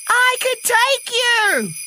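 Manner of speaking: very high, drawn-out excited call. A narrator's reading. Jill calls from her flying cat sleigh, high excited shout. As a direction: show it off, calling across the sky.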